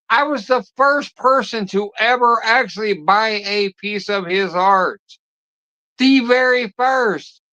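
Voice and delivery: high, heated rant at a banned viewer; furious rant. Real, not acted. Streamer rants at banned viewer.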